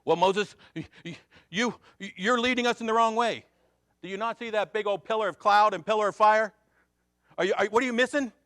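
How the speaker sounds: high, loud and exasperated; loud acted confrontation. Preacher acting out the quarrel over Moses' leading, loud exasperation.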